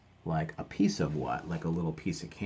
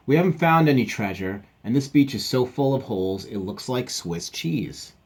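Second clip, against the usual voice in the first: lightly acted grumble, higher and louder at first; a hint of a let-down character; mildly voiced treasure hunter; 6 semitones higher, 9 dB louder than usual. Treasure hunter reporting an empty beach, mildly dramatic.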